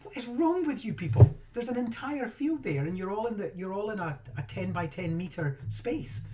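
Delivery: watching people from the window, high and exasperated; exasperated inner rant. Retold or imitated. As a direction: rant at the crowds through the window. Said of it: "I'm just thinking".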